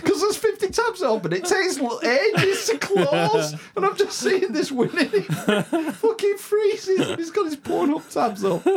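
high, loud laughing telling. Real, not acted. Electrician cracking up telling friends about the porn tabs. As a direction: laugh through every word.

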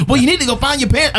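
high, fast mock yell; imitated insistent dad. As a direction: insist urgently as a dead-serious dad, high and quick. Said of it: "he was dead serious".

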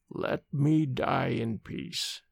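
slow, soft, dying man's plea; dying man, very slow and quiet. A narrator's reading. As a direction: drag each word out, weak and low. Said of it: "he said slowly".

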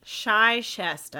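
Chichester slowly as "shy-SHESS-ter"; joke pronunciation. Shown as a demonstration. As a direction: say Chichester starting with "shy", falling. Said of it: "how do you say that?".

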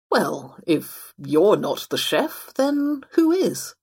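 slow, drawn out, rising high at the end; pondering. Acted. Fox wondering who is the chef, slow and pondering. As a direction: ponder it slowly, lifting into a puzzled question.

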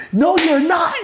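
high, loud, outraged refusal; yelling. Retold or imitated. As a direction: yell it as the furious friend. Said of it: "yelling at me like".

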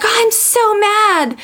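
put-on high, slow, whiny child's voice; upset little daughter. Mother quoting her angry daughter, high slow childlike whine.

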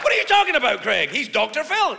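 high, baffled objector voice; protesting objector. Acted. Objector defending Doctor Phil to Craig, high protest. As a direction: protest high as the other side.

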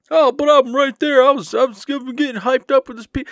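high, loud mock protest; mocking past self. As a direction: parrot an overeager excuse until cut off. Mocking own past excuses.

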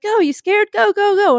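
high-pitched mock coaxing call; urging her on. Boat guy hurries a nervous diver into the water, urging. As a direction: imitate an impatient guide calling out.

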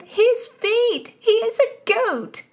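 very high, loud squeal; shrieking. A narrator's reading. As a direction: shriek it right at the top of the voice. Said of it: "shrieked Mary Howard".

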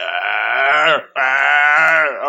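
loud imitated dog screams; mock dog shriek, twice; death cry. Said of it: "That motherfucker sounded like".

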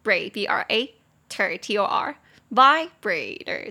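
"vibrator" broken into syllables, very slowly; slow spelled syllables. Shown as a demonstration. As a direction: spell "braid" and "ter" letter by letter, slowly. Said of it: "最高的音节在第一音节".

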